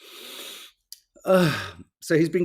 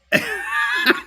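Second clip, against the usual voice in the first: squealing burst of laughter; real squeal of laughter; high laugh. Real, not acted. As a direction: let a high giggle burst out. Laughs at Dracula image.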